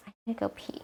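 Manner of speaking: breathy unvoiced whisper. Real, not acted. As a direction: whisper it so nobody hears. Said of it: "Why are you whispering?".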